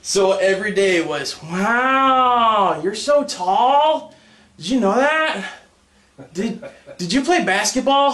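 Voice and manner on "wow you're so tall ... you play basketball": high, slow awed gushing; gushing onlooker. Retold or imitated. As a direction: gawk like an amazed stranger. People gushing at tall kid.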